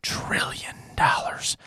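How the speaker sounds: slow, drawn-out stage whisper; hushed mock whisper, word by word. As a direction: whisper it slowly into the mic, long gaps between phrases. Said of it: "whispering in the microphone".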